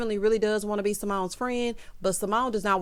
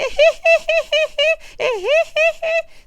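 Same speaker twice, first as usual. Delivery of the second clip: high cackle; 8 dB louder than usual. Real, not acted. Laughing at her own impression.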